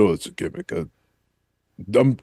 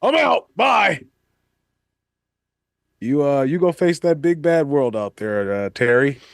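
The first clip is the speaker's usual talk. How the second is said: back in Hogan mode; 6 semitones higher than usual. Acted. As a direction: snap back into the hype character. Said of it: "Get back in my mode".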